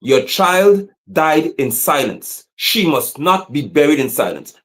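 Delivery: put-on very slow, heavy, leaning on child; slow grave chant; slow solemn refrain. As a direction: weigh each word of the refrain.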